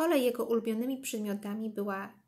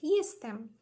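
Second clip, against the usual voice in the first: high, louder arrival call; calling out to mum; boy shouting; 5 semitones higher, 5 dB louder than usual. A narrator's reading. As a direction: announce yourself loudly like a child home. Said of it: "krzyknął Wojtek".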